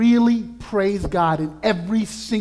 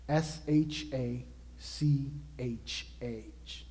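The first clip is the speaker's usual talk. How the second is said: usual speaking voice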